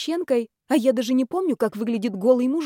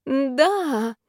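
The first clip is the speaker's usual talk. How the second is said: higher, faked moan of passion; mock passionate moan; 5 semitones higher than usual. A narrator's reading. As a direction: moan it as a put-on. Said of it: "более похожее на стон страсти".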